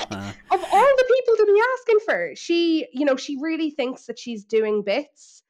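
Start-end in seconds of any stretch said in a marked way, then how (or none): 0.0-2.3 high, loud incredulous laugh; laughing disbelief. Real, not acted. Laughing in disbelief at Kyle's choice of mentor.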